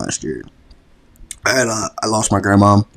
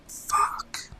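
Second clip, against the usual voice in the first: stunned whisper; 11 dB quieter than usual. Retold or imitated. Reacting to grandma's death, retold.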